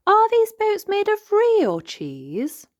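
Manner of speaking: high, slow, wondering little piglet; a small girl asking in amazement; Peppa voice. Acted.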